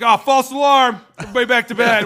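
loud, high, breezy call; mock announcement. Cops telling the team to go back to bed, loud mock call. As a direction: bellow it like a cop dismissing everyone.

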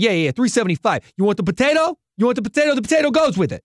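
fast, high New York counter guy; New York accent for the server. Retold or imitated. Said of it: "the guy's like".

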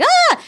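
short, high mock shriek; high-pitched imitation scream; retold startled scream. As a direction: yelp as if suddenly caught. Retold heroine gets caught.

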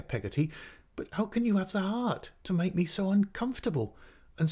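high, distressed, aggrieved mother; tearful outcry. A narrator's reading. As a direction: lament as if driven to distraction. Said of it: "both in tears and both talking".

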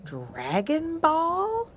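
high, slow puzzled question; drawn-out, wondering question. Acted. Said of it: "wondered Power Dog".

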